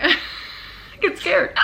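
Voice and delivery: sudden startled yelp. Real, not acted. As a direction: jump at the flame. Lighter flares up.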